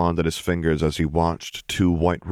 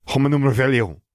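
sharp, loud and higher spat incantation; spat spell; 8 semitones higher, 4 dB louder, faster than usual. A narrator's reading. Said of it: "Claire spat".

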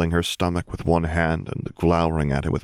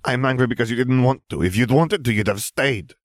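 raised, high-pitched indignant complaint; hurt and cross, pitched up; angry accusation; 7 semitones higher than usual. A narrator's reading. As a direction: accuse your partner, voice pushed up. Acted quarrel, Fleur accusing Harry.

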